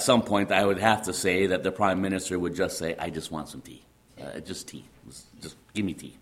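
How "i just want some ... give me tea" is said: low, soft, mock-worn-out request; hushed, lightly acted plea; quiet plea. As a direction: drop it right down, as if done with questions. Prime Minister tired of endless questions.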